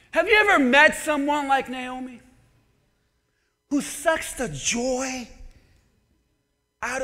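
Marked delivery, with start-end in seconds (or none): none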